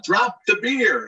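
high, slow called-out order; retold yell. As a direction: call it like a man in the stands. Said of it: "you hear the guy yell".

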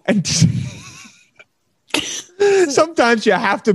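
high, giggly start of a line; real laughter in the voice; laughing through it. Real, not acted. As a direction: let a laugh break into the words. Laughing mid-sentence.

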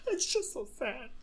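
high, soft, put-on tearful squeak; hammed-up sad little voice; mock weepy. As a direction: pretend to choke up over a tear-jerker. Mock weepy after tear-jerking moments.

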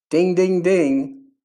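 ding it out like a little bell. Retold or imitated. Telling listeners a God moment is coming, mock bell chime.

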